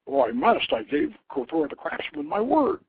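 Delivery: a prince insisting on his promise; confident brother. Acted. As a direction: brush off the warning with resolve. Brother answers sister in story.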